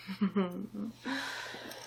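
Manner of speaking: soft, teasing closed-mouth chuckle; sly little villain's snicker; villainous chuckle. Acted. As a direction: chuckle quietly, toying with your victim. Villain toying with the Sultan.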